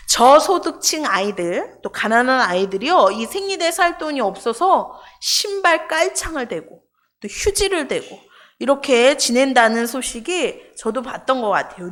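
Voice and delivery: ordinary talk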